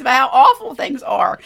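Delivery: high, loud wail of exaggerated woe; hammed-up crying out, half laughing; mock complaining. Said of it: "He's crying out to God".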